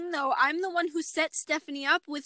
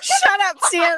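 loud, higher "shut up"; playful shout; 4 semitones higher, 9 dB louder, slower than usual. Real, not acted. Embarrassed friend yelling at Sienna after the teasing, playful. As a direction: yell back at the tease, laughing.

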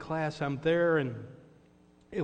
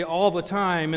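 louder called response; 4 semitones higher, 9 dB louder than usual. Retold or imitated. Pastor calling the response back to the congregation, loud. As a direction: belt it out to the pews.

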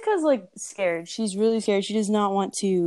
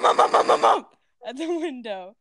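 knocking sound effect; 4 semitones higher than usual. Retold or imitated. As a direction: punch out each bump like a knock. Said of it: "she wakes up to bump".

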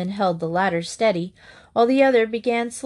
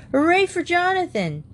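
high excited cheer; cheering echo; 10 semitones higher than usual. Acted. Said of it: "the other children echoed".

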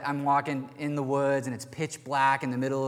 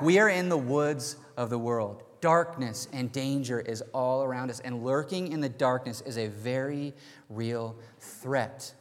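usual speaking voice

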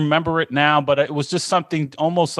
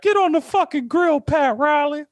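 mocking coworker; 14 semitones higher than usual. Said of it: "they were like".

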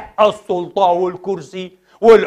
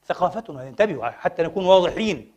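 soft, low, falling mention; subdued aside; 3 semitones lower, 6 dB quieter than usual. Real, not acted. Preacher first naming our culture to congregation, quiet aside. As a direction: drop it in quietly.

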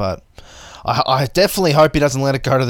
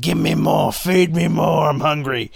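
hungry fighter voice; 5 semitones higher than usual. Retold or imitated. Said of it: "went over to Dana and said".

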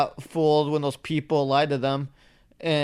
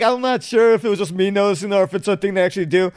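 usual speaking voice

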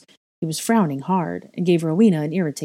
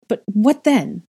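raised, louder, urgent question; pressing; 5 semitones higher, 5 dB louder, slower than usual. A narrator's reading. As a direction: press for more. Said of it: "I pressed".

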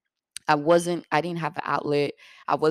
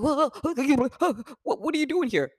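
stuttering panic; 10 semitones higher than usual. Retold or imitated. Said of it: "she started stuttering and shit".

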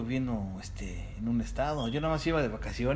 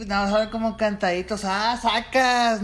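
loud, high sing-song Monterrey accent; 9 semitones higher, 8 dB louder than usual. Shown as a demonstration. Asked to talk like Monterrey folks, loud sing-song Monterrey accent. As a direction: sing it like a regio. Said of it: "A ver, habla como ellos".

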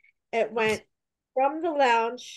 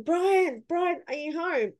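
mild mock yell; 7 semitones higher, slower than usual. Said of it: "And I was yelling".

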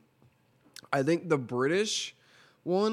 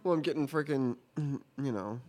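ordinary talk

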